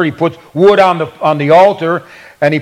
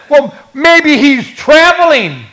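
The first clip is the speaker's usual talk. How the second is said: sarcastic; 7 semitones higher than usual. Acted. Elijah mocking the silent god's worshippers, sarcastic. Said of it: "Elijah used the gift of sarcasm".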